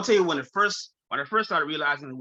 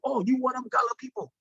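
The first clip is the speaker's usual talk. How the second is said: imitating Rasta islanders; 7 semitones higher than usual. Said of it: "a lot of people know".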